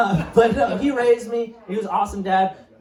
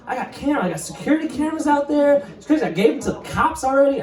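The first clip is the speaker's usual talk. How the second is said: bluffing; 9 semitones higher, faster than usual. Retold or imitated. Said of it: "I was just lying".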